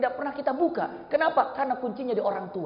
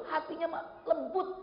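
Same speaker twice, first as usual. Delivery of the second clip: normal talking voice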